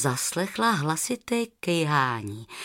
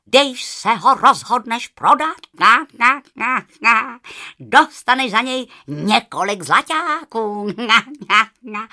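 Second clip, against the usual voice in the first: louder honking goose; 3 semitones higher, 7 dB louder than usual. Acted. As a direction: honk cheerfully at the girl.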